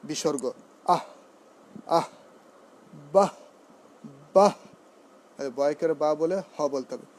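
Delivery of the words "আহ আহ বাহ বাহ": "আহ" and "বাহ" as short bursts ending in a half h. Shown as a demonstration. Teacher drilling visarga, "আহ" and "বাহ" cut short with a half h. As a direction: punch out each "আহ", "বাহ" with an aspirated stop. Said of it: "অর্ধ হয়ের মতো উচ্চারণ হয়".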